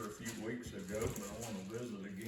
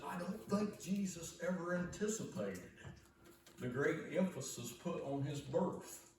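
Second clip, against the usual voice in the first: southern drawl; 6 semitones higher than usual. Retold or imitated. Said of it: "Doctor Stanley's southern drawl that he has".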